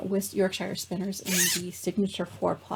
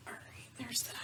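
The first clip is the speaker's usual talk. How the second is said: whisper; 4 semitones higher, 17 dB quieter than usual. Real, not acted. Aside while rearranging things.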